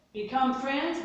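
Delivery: usual speaking voice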